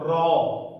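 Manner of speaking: را said thick and emphatic; heavy ra. Shown as a demonstration. Teacher shows the heavy ra, thick and full. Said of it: "یہ را موٹا ہو کر ادا ہوگا".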